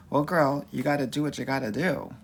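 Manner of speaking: usual speaking voice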